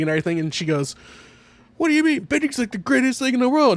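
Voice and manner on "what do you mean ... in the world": high-pitched, louder mock protest; indignant retort. Retelling her answer to the protester, indignant. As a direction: imitate an outraged heckler, voice pitched way up. Acted quarrel from the show.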